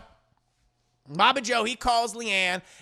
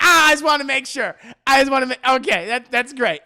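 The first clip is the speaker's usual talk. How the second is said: loud, higher, overlapping backpedal; flustered relief; 3 semitones higher, 8 dB louder than usual. Acted. Leanne backs off after Lily's answer, loudly flustered. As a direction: burst out, then cut yourself short. Mother hastily reassured, backing off.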